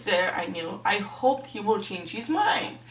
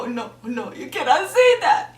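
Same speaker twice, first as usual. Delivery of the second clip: high, loud laughing no; mock outrage, laughing it off; laughing protest; 5 semitones higher, 7 dB louder than usual. Outraged reaction to the quote.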